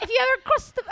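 high, loud mock British; bad British accent. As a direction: try British and overshoot. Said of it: "a better British accent than that".

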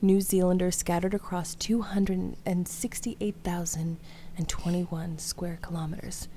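low, hushed and breathy reading; sultry voice. Acted. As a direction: read it like a late-night seduction. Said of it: "Maybe I'll read this in a sultry voice".

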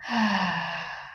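one long, slow exhale; long sigh. Real, not acted. Guide settling in before the meditation, long slow sigh.

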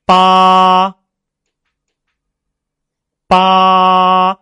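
八 very high, loud and dead level; first-tone drill. Shown as a demonstration. Teacher leading students in repetition, 八 high, loud and level. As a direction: hold 八 on one high note. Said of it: "好，跟我读一个字".

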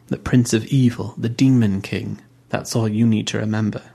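ordinary delivery, nothing marked

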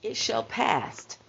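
high, put-on voice saying It shall past; mock self-correction. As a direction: play your past self puzzled and sure of It shall past. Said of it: "and I said".

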